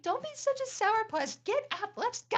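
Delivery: high, loud chipper scolding; hammed-up perky mom nagging; bossy cheerful mom. Mom urging her sulky son out of bed, high and loud. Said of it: "And I'm like".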